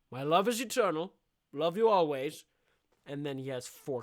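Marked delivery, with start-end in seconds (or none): 0.1-2.4 mock suitor declaration. Suitor declares love again.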